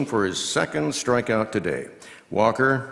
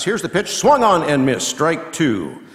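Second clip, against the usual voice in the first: excited swing call; 6 semitones higher, 6 dB louder than usual. Acted.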